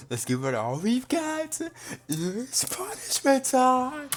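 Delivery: very high, loud, slow villain cry; drawn-out gleeful shout; gleeful announcement. Acted. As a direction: call out punishment time with glee. Monokuma announces execution.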